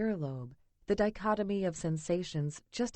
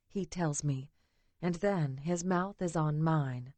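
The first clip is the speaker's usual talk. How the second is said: ordinary delivery, nothing marked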